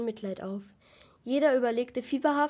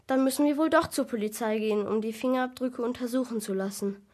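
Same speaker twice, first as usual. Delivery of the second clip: usual speaking voice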